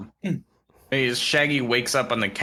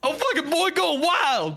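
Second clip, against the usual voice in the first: much higher, hyped Shaggy. Retold or imitated. Shaggy hyping up Scooby, very high cheer. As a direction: cheer the dog on. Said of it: "and he goes".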